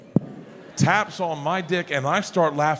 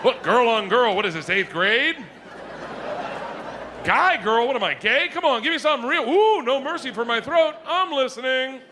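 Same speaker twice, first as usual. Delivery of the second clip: higher, laughing mock commentary; mock picky browsing; 7 semitones higher than usual. Comedian mimicking himself browsing porn, higher mock snobbery. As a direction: sneer at each clip like a snob.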